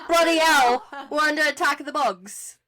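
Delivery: cartoonish mock British accent with Bloody hell punched out; bad British accent. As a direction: overdo the British accent as if the house is under attack. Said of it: "That was really bad".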